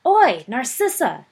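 put-on a boy taunting his cousin across the great hall; shout across the hall. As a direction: call her across a crowded hall. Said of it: "Sirius shouted across the hall".

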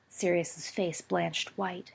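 ordinary delivery, nothing marked

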